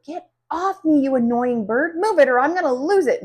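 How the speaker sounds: fast, loud exasperated shouting; angry scream. A narrator's reading. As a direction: yell at the bird to get off. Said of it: "Missus Green screams".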